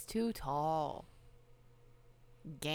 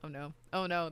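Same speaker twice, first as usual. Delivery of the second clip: ordinary talk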